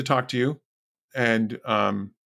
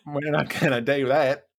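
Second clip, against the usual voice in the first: put-on silly Australian accent; 3 semitones higher than usual. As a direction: play a silly Monty Python Australian refusing. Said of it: "it's a very silly Australian accent".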